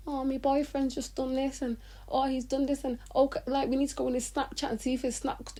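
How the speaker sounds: high, airy mock of a gossiping girlfriend; mock girly gossip. Mocking girls venting about boyfriends.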